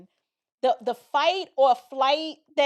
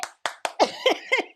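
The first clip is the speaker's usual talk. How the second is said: laughing; 11 semitones higher than usual. Real, not acted. After the mama impression.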